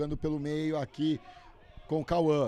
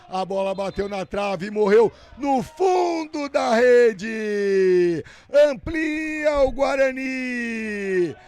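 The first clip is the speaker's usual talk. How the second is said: loud, high, slow and stretched; excited goal recap; 6 semitones higher, 8 dB louder, slower than usual. Real, not acted. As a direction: ride the goal euphoria, drawing words out. (narração empolgada pós-gol)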